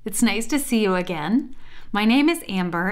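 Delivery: ordinary delivery, nothing marked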